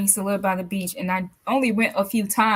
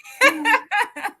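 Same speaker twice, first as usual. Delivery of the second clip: a quick high laugh, real and light; high bright laugh; 12 semitones higher, 6 dB louder than usual. Real, not acted. Host laughs.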